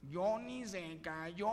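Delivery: slow, hammed-up nasal punchline; mock nasal voice. Mark reports to the preacher in a mock nasal voice. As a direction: drag it out through the nose. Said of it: "Estoy gangoso".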